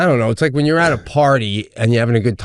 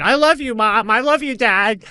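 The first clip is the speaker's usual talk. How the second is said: put-on higher, sing-song happy little girl; chirpy kid voice; 9 semitones higher than usual. Father imitating his daughter skipping into the kitchen, chirpy. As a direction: skip in bubbly and sweet.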